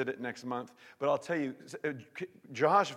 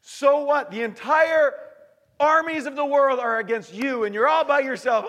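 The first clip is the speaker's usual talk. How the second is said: high, loud and urgent; loud challenge; 12 semitones higher, 13 dB louder than usual. Acted. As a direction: hurl it at the crowd. Preacher builds dramatic challenge.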